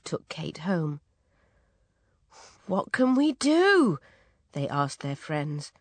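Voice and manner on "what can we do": high, loud worried plea; desperate appeal. A narrator's reading. Parents asking their friends for help, desperate appeal. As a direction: appeal helplessly for advice.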